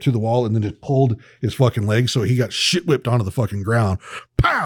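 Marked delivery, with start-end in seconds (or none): 4.4-4.7 impact sound. Retold or imitated. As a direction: punch out the crash sound. Impact as they hit floor.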